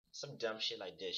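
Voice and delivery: ordinary talk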